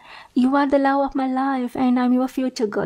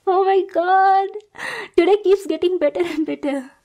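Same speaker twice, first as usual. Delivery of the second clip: a stalker thrilled her crush finds her cute; giddy squeal; 7 semitones higher than usual. Acted. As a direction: squeal like a fan meeting her idol.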